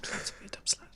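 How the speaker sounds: very soft, voiceless whisper; playful whisper. Pretend. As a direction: whisper it like a cheeky mantra. Playfully repeats the advice in whisper.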